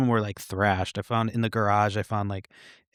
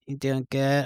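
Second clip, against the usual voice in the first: higher-pitched mock Boston accent; 4 semitones higher than usual. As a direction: mimic a Boston woman's friendly check-in, pitched up. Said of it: "in her fucking Boston accent".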